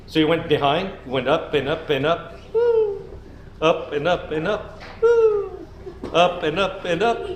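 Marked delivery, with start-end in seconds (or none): 2.5-3.0 squeaky high woo on the climb. Acted. Climbing the slide. 5.0-5.7 squeaky high woo on the climb. Acted. Climbing the slide.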